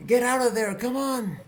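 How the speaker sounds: high-pitched urgent yell. Acted. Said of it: "and yells".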